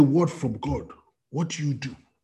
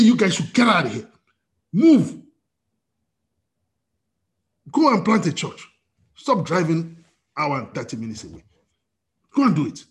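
raised commanding voice of God; 3 semitones higher than usual. Retold or imitated. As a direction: urge them out with authority. God's voice in retold vision.

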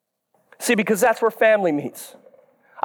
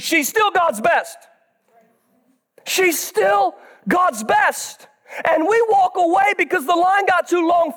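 high, stretched-out sermon cries; full-force preaching; 8 semitones higher than usual. Acted.

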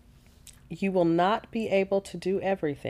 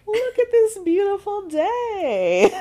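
high, slow, exaggerated delight; overly bright sarcastic praise; mock cheerful; 5 dB louder, slower than usual.

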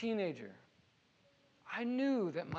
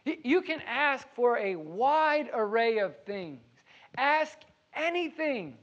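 higher, louder, drawn-out "Ask anything."; stress on anything; 3 semitones higher, 3 dB louder than usual. Shown as a demonstration. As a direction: lean hard on "Ask anything", stretching it slowly. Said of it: "this word anything would all be capital".

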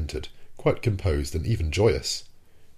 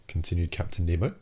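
usual speaking voice

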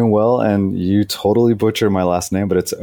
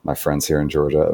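normal talking voice

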